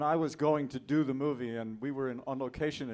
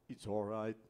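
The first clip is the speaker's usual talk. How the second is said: calm giant's reply; 3 semitones lower than usual. Retold or imitated. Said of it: "And he said".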